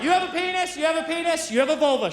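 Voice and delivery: put-on high, shouting child voice going down the line; a toddler labelling everyone; little girl pointing.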